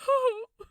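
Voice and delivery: a single high, choked sob; one broken sob against his cheek. Acted. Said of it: "let out a sob".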